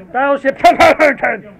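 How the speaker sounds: big, slow, booming proclamation of Battleship Kapumpkin; mock grand announcement. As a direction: belt the title like a movie trailer. Triumphant comic title announcement.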